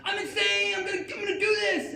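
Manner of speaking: high, loud maniac shriek; crazed screaming. Acted. Driver ramming the mall guard's car, crazed high scream. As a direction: scream it like a wild getaway driver.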